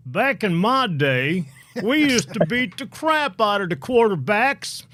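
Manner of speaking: put-on old man voice. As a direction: play a cranky old man back in his day. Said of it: "my old man voice".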